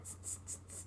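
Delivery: unvoiced teasing tss; monkeys' tss. Monkeys mocking the peddler with a whispered tss. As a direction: hiss tss back like cheeky monkeys.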